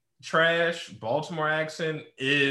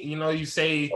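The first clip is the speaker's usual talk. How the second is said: ordinary delivery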